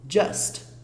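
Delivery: "just" said djast with a dark u; English just. Shown as a demonstration. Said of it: "olha a pronúncia".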